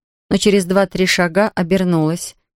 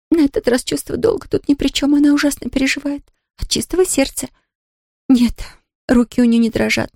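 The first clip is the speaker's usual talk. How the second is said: lightly higher upset maid voice; a worried servant woman; 6 semitones higher than usual. A narrator's reading. Nancy voiced by reader.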